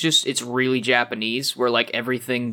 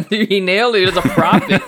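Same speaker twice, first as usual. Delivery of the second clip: laughing; 5 semitones higher, 4 dB louder than usual. Real, not acted. Laughing at a prophetic tweet.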